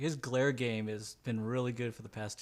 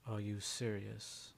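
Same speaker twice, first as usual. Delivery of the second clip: deadpan disbelief; 2 semitones lower, 7 dB quieter, much slower than usual. Acted. Contact answers flatly, not looking.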